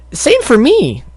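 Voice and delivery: high squeaky try; failed squeaky voice. Pretend. Narrator testing a squeaky filly voice. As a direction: squeak it out, badly. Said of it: "Oh, that's terrible".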